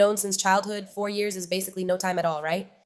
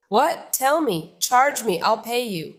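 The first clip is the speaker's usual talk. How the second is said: indignant outburst; 2 semitones higher, 5 dB louder, slower than usual. Real, not acted. Telling viewers about a friend's lie, indignant and loud. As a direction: throw the accusation back at her. Denying the credit card lie.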